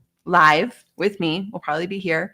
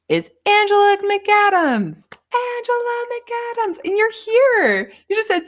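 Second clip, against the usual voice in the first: much higher, slow, sung-out announcement; gleeful high-pitched name reveal; excited winner call; 5 dB louder, slower than usual. Real, not acted. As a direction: ring out the winner's name like a cheer.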